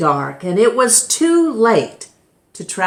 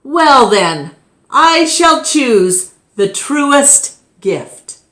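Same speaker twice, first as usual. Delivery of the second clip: keeper declaring; 7 semitones higher, 5 dB louder, slower than usual. Acted. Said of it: "the keeper said".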